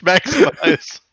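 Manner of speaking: high shouted rallying call; battle cry. Retold or imitated. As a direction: call the troops to arms.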